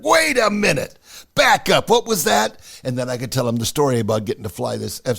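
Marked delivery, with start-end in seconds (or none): 0.0-2.6 higher, louder startled stop; mock double take. Friend reading the logbook, suddenly startled and loud. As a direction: play the stunned buddy catching it. Buddy spots F16 in logbook.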